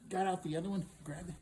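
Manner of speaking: usual speaking voice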